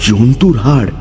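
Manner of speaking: usual speaking voice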